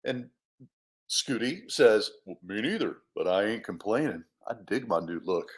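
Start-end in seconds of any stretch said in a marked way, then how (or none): 2.3-5.5 low, soft bear voice; easygoing bear, pitched well down; laid-back bear. Acted. Bear happy with his new look, laid-back.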